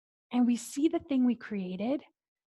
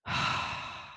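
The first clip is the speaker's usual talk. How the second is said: long breathy exhale of relief; whispered sigh of release; relieved sigh; 4 dB quieter than usual. Shown as a demonstration. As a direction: let it all out in one sigh. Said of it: "you feel your whole body relax".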